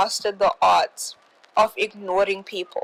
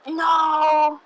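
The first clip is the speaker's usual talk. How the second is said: long, high-pitched "no"; drawn-out refusal; 7 semitones higher than usual. Retold or imitated. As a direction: sing out a high, stretched refusal. Politely refusing an offer of friendship.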